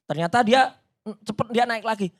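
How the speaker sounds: ordinary talk, nothing marked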